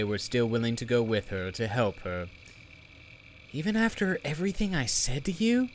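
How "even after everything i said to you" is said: high, fast plea; girl voice. Acted. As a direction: ask it in disbelief, higher. Zelly pleads, deflated.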